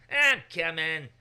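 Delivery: loud, slow drawn-out I'm coming; a sulky kid answering a parent; child whining back to parent calling; grudging reply. Retold or imitated. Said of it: "The parent calls up to the child".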